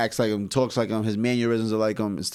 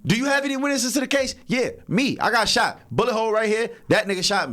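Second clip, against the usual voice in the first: higher, playful courtroom voices; mock trial scene, pitched up; acted courtroom bit; 8 semitones higher than usual. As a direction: act out the judge and the witness, half laughing. Acts out a courtroom exchange.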